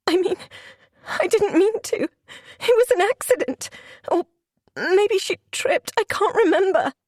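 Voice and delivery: high, frantic, panicked excuses; panicked pleading. Acted. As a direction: beg your way out, panicking. Desperate denial.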